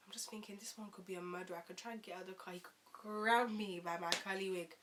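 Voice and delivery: very soft, low near-whisper; hushed inner thought. Retold or imitated. Thinking of escaping the car, hushed and wary. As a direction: murmur it like a secret worry.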